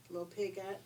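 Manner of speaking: usual speaking voice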